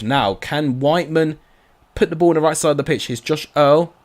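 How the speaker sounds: ordinary talk, nothing marked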